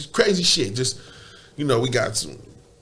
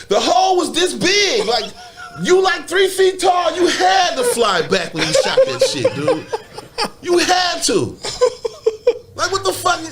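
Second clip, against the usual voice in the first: incredulous shout; 10 semitones higher than usual. Real, not acted. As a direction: yell it through the laughter, can't believe it. Incredulous punchline, laughing.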